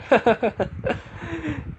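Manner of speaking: high-pitched drawn-out giggle; high laugh. Acted. Laughing at the stepsister's outburst, high-pitched giggle. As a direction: giggle high up after the tease. Laughing after the teasing line.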